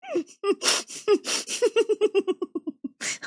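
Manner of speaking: long giggle fit that finally breaks; helpless laughter. Real, not acted. Co-host cracking up at the victim's name. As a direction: collapse into laughter.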